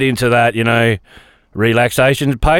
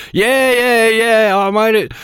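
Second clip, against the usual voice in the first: sarcastic self-mockery; 8 semitones higher, slower than usual. Mocking own proud selfie.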